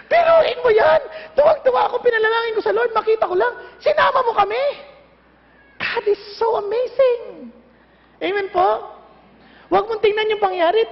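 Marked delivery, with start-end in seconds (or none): 0.1-4.6 higher, louder, voice breaking with joy; tearful joy. Retold or imitated. Companion at Brooklyn Tabernacle thanks God, tearful with joy. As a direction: cry it out, overwhelmed and grateful.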